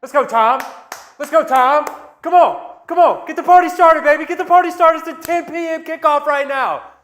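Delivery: loud, high party-bro hype; frat bro. Acted. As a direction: pump up the crowd like a frat bro. Hyping a beer chug.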